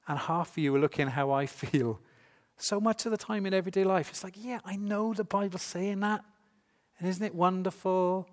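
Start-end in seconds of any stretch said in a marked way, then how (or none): 4.4-8.3 complacent agreement. Acted. Mimics a complacent everyday believer.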